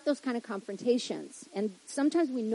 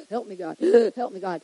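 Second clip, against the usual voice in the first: mock gasping prayer; 4 semitones lower than usual.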